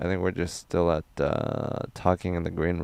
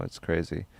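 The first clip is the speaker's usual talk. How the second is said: normal talking voice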